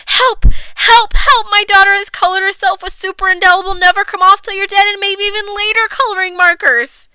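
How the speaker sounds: high, loud cries for help; frantic breathless-run plea to the doctor; panicked mother. A narrator's reading.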